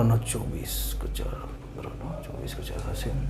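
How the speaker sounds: very soft whispered figures, trailing under the breath; muttering calculation. Real, not acted. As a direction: count to yourself in a whisper. Works out sum to himself.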